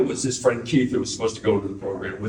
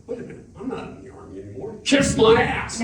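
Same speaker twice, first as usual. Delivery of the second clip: rising to a high, laughing "kiss my ass"; defiant retort; 10 semitones higher than usual. Retold or imitated. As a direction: tell off the sergeant with gleeful cheek. Retold defiance to master sergeant.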